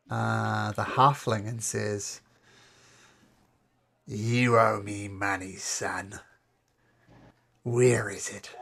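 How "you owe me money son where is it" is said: menacing thug. Acted. GM voices thug Golfer.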